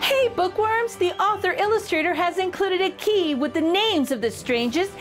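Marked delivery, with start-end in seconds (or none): none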